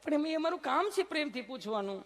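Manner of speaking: very high, sweet nurse voice; loving nurse. Retold or imitated. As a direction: answer him all sugar and innocence. Nurse insists.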